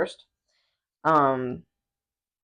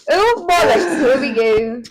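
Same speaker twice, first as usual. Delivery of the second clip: loud, high mock British exclamation; put-on British accent; 6 semitones higher, 6 dB louder, slower than usual. Threatening to keep it all episode, mock British accent. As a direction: throw yourself into hammy British. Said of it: "I'm gonna do it for the rest".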